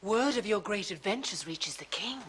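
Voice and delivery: usual speaking voice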